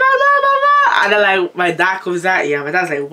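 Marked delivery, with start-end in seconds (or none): 0.0-1.0 very high, loud wail for help, re-enacted; screaming for mum. Teller grabbed by the braids calling mum, high re-enacted scream. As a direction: scream for your mother in a piercing mock panic. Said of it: "I started screaming".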